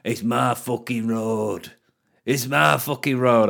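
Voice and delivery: lightly voiced drunk insisting it's his road; a hint of a slurring drunk; drunk man.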